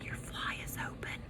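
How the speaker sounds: soft unvoiced whisper; hushed whisper to a classmate. A narrator's reading. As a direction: breathe it secretly. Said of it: "whispered to him".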